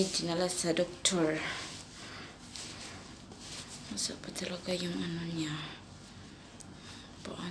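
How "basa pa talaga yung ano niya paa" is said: unvoiced aside; hushed whisper. Real, not acted. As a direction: whisper so the cat stays calm. Whispering near the cat.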